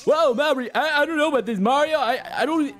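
high cartoon plumber voice; Luigi voice. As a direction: squeak it like a nervous Luigi. Plays Luigi talking to Mario.

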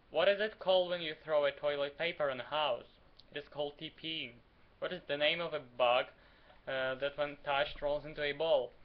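ordinary talk

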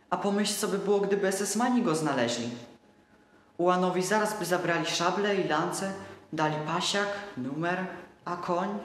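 put-on a boy scaring himself; childlike anxious telling; worried little boy.

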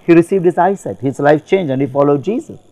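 ordinary delivery

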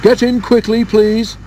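high, loud call to passengers; high urgent call. A narrator's reading. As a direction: hurry them aboard in a high voice. Engine calling to passengers.

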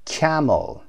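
Camel with CAM hit, falling away after it; first-syllable stress. Shown as a demonstration. English teacher showing learners where stress falls, first-syllable stress on Camel. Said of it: "on the first syllable".

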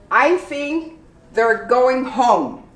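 slow, each word of "they're" spelled out; slow careful. Shown as a demonstration. Teacher slowing down to model "they're" as there. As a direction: draw out "I think they're going home". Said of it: "You pronounce there, not they are".

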